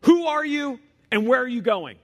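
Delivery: loud, high soldier's challenge; gruff mocking soldier. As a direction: call out like a Roman guard. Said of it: "a Roman soldier's voice cries out".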